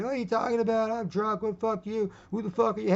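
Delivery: put-on high, belligerent drunk voice; hostile drunk. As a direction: snarl back like an angry drunk. Drunk guy answers aggressively.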